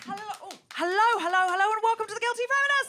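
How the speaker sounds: loud, fast rising welcome; calling out to crowd. Real, not acted. Host welcoming the live audience, loud and bright. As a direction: whip up the room with greetings.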